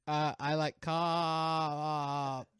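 "I like cops" drawn out very slowly, the lips held apart; lips not touching. Shown as a demonstration. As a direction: stretch out "I like cops" so the lips never close. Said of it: "He points at me".

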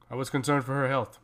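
usual speaking voice

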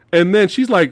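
normal talking voice

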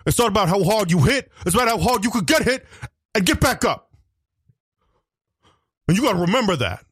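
a boxer pumping himself up; Rocky Balboa impression. Rocky giving a fight speech to the co-host. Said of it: "Rocky Balboa".

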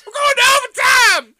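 loud, high-pitched holler; mock yell. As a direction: yell it out like a big announcement. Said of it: "Don't yell".

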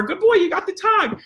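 put-on high, happy good-boy cheer; delighted squeal to a dog; excited praise. Shown as a demonstration. Trainer praising a dog at tug, high and excited. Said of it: "It wasn't, you know, like".

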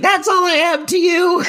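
very high-pitched mock outrage; imitating a wounded woman, voice way up; mock-hurt retort. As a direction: squeal it as an affronted protest. Acted hurt retort from Fran.

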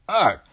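high, loud grunt of disgust; revolted exclamation after a mouthful of fur; disgusted ugh. A narrator's reading. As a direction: spit it out with a sharp ugh. Spitting fur out of his mouth.

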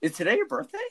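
high-pitched, quick imitation of the cashier; mock surprised cashier. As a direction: squeak it out like a surprised clerk. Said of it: "And he's like".

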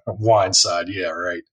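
scoffing. Retold or imitated. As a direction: brush the idea off with a smirk. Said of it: "Kevin and I were like".

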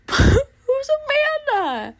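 high squealing laugh at own slip. Real, not acted. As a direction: crack up at your own mistake. Laughs at her own name slip.

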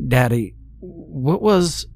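put-on high, halting little-kid voice; a child timidly asking, cut short; small boy's high-pitched plea; frightened child. Child pleading, cut off by blow.

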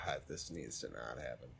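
low, hushed mutter to oneself; dreading inner voice. Retold or imitated. Performer recalls dreading the teen night, low muttered thought. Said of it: "I was like".

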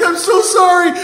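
high, wailed apology; hammed-up tearful sorry; crying kid. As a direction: bawl it like a guilty kid. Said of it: "the kid starts to cry too".

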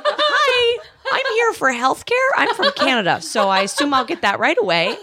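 very high laughing chirp; bright innocent high voice; naive sweet Canadian. Retold or imitated. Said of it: "Hello, I'm Andrea".

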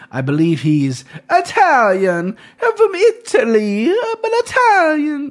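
hammed-up Italian accent, high and slow; playful bad Italian accent; terrible Italian accent. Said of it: "That's a terrible Italian accent".

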